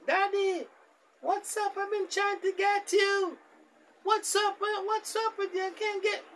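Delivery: put-on high falsetto young girl voice; daughter's voice. Daughter calling her busy father, high girlish falsetto. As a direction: squeak it up like a whiny daughter on the phone.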